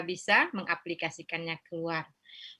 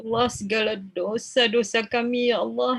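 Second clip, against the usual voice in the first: pleading prayer building from earnest to higher and louder; fervent prayer; 6 semitones higher, 12 dB louder than usual. Acted. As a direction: rise into an impassioned plea for forgiveness. Prayer rising to its climax.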